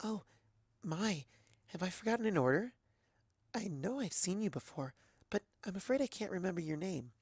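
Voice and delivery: a bashful pegasus apologizing to a stranger; Fluttershy, shy and timid. A narrator's reading.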